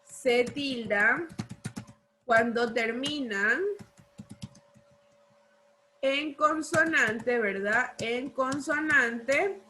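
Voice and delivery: slow dictation in separated chunks; dictating. Real, not acted. Teacher dictates the rule to copying pupils, slow dictation. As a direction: draw it out, pausing for pupils to write.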